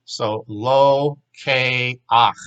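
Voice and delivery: slow, each syllable of lo ke ach set apart. Shown as a demonstration. Hebrew teacher showing students how to build the word, slowly by syllable. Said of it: "Take the ka and make it an A here".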